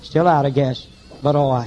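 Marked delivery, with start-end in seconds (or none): none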